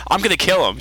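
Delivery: quick comeback with a touch of heat; retort. Retold or imitated. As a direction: fire it back as a lightly acted threat. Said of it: "Jack immediately retorts".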